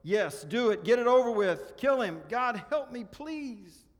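high, very loud anguished cry; full-voiced plea breaking off on please; desperate bellow. A narrator's reading. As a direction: bellow it out, begging to be saved. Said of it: "The ghost bellowed".

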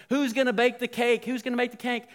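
high, loud questioning; excited mock rallying cry; acted calling out. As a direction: call for a volunteer. Calling out for a baker.

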